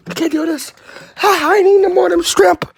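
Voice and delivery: high, loud strained complaint; pained shouting. Real, not acted. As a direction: shout through the burn. Burning mouth, high and loud.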